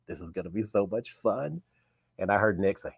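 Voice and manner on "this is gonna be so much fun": quiet aside to the contestant next to him; under his breath. Retold or imitated. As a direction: keep it secret from the crew, soft and private. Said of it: "I just under my breath said".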